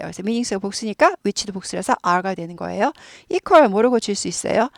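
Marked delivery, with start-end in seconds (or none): none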